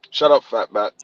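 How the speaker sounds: put-on low, soft, dismissive kid's jab; sassy grandson. Grandpa imitating grandson's low jab. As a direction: mutter the insult.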